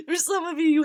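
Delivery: high, loud laugh spilling into the words. Real, not acted. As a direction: giggle it out, still amused. Laughing after fuckboy joke.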